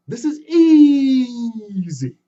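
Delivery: very slow strained "easy" with a cry; straining effort. Acted. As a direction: grunt it out mid-climb. Anansi straining again.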